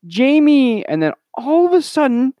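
high, loud, over-the-top exclamation; mock dramatic cry. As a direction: blurt it out shrieky and high in mock amazement. Dramatic mock retelling of the film.

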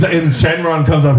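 normal talking voice

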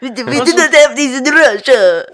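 bad Russian accent through laughter; laughing mock Russian accent. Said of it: "What are those French accents?".

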